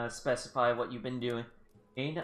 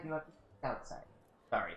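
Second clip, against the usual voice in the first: usual speaking voice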